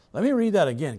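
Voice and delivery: usual speaking voice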